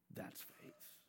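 whispered, emphatic. Real, not acted. Preacher whispering the point to the congregation. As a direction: breathe it out for weight. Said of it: "whispered emphasis".

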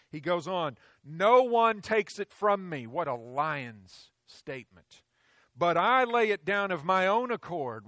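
loud, rising pulpit delivery; full-force preaching. Acted. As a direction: preach it out with conviction.

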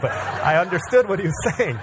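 usual speaking voice

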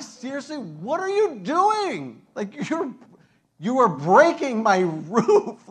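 loud, high, slow indignant yell; outraged homeowner. Retold or imitated. Homeowner yelling up at the men tearing his roof. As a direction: demand answers from the men on the roof.

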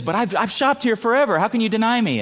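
high, pleading lady's voice; pleading old lady. Retold or imitated. Longtime shopper pleads with the clerk, high and whiny. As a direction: whine it like a wounded loyal customer. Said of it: "And then she says".

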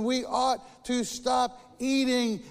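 a hint of two friends promising to stop; storybook friends vowing to quit, slow; Frog and Toad. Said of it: "they say".

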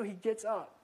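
usual speaking voice

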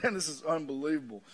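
ordinary talk, nothing marked

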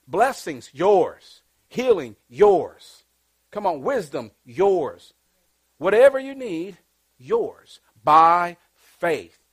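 loud, slow rising sermon build; preaching at full force. Acted. Preacher calling out to the congregation, building loud. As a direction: preach each blessing out to the crowd. Preaching peak on blessings list.

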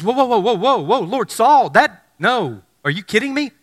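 startled and pushing back, hammed up; alarmed protest. Ananias protesting to the Lord, mock alarm. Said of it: "immediately he says".

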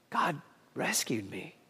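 soft, slow, awed murmur; stunned hush. Retold or imitated. Peter alone at night, awed and hushed. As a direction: whisper it in disbelief.

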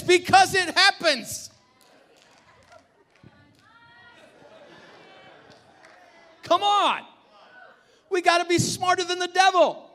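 high, very loud preacher's shout; full-force preaching. Real, not acted. Pastor warning church the devil wants parents offended, shouted preaching. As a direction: thunder it out to the whole congregation.

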